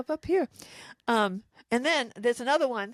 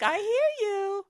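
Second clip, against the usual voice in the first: acted quote; 10 semitones higher, much slower than usual. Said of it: "I'm like".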